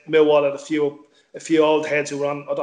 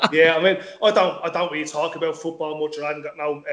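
ordinary talk, nothing marked

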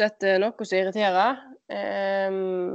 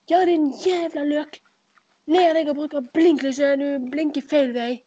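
drawn-out, higher-pitched road-rage shout; retold angry yell; 5 semitones higher, 3 dB louder than usual. Driver yells at the car that signalled wrongly, angry retold shout. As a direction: re-enact yelling curses from behind the wheel. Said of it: "og så ropte jeg bare sånn".

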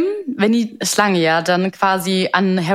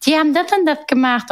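meek tattling; 9 semitones higher than usual. Retold or imitated. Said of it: "sehr kleinlaut zu seinem Vater hin und sagt".

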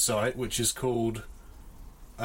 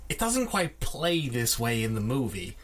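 ordinary delivery

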